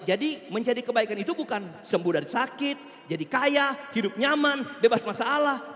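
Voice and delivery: loud, high, driving preacher delivery; preaching at full force. Acted. Preacher rebuking prosperity teaching to the congregation, loud and fervent. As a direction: hammer home each false blessing.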